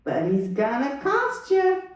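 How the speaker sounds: slow, drawn-out lady's warning; shelter lady. Retold or imitated. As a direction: stretch the words, pitch climbing. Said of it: "She said".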